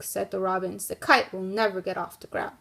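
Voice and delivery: lively storybook read-aloud for a class; reading to children. Acted. Teacher reading the kite story to her class, in a lively storybook voice. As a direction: read it like story time for young kids.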